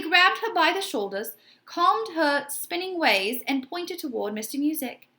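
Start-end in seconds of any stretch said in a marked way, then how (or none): none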